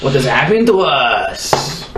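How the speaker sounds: slow, grand declaration; dramatic proclamation. Acted. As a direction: announce the new power. Dramatic transformation line.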